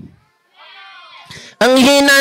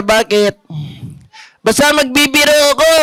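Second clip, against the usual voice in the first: normal talking voice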